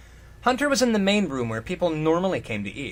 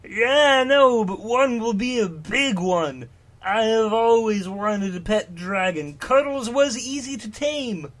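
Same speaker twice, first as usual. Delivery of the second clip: higher, louder giant's voice, slow at times; Shogrid voice; 6 semitones higher, 5 dB louder than usual. Acted. Shogrid telling Hunter about his pet, high and gushing.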